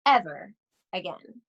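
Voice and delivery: ordinary delivery, nothing marked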